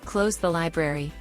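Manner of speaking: normal talking voice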